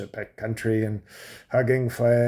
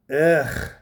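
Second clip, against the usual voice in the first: short, higher, louder imitated ugh; mock disgusted groan; 4 semitones higher, 5 dB louder than usual. Wife reciprocates in bed, imitated higher, louder groan. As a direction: answer with a loud, pitched-up comic groan. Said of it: "and you reciprocated with".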